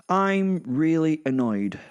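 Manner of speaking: sulky annoyed reply. Acted. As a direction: spit it out slowly, fed up. Narrator answers Santa crossly.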